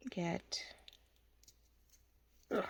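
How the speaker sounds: soft whisper; breathy whisper to herself. Real, not acted. Whispering while working.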